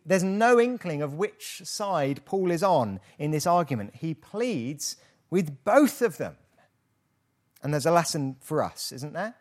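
ordinary delivery

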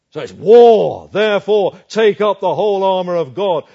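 rally the church for war at full volume; preaching at full force. Real, not acted. Preacher's climax, call to battle.

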